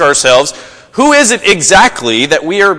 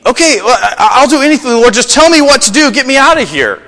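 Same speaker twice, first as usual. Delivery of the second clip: desperate pleading; 8 semitones higher than usual. Retold or imitated. Joe begging God to get him out, high and frantic. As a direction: beg to be rescued. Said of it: "Joe pleads with God".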